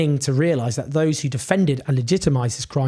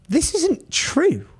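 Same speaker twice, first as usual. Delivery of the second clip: slow, high, drawn-out outrage; incredulous, deliberate rebuttal; emphatic denial; 13 semitones higher, much slower than usual. Real, not acted. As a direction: lean hard on every word of the denial. Flat denial after quote.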